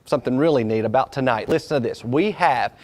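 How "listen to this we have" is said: normal talking voice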